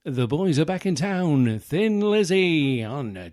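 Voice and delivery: high, loud hammed-up presenter voice; TV announcer. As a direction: announce it like a cheesy chart show. Mock TV chart show announcer.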